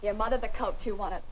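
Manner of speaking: normal talking voice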